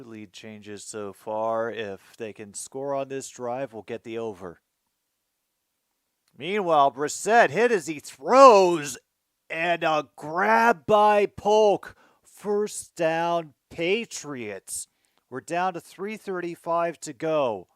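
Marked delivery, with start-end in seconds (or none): 6.4-17.7 loud, high, slow excited game call; live play-by-play. Acted. Fan calling the Patriots drive to viewers, excited play-by-play.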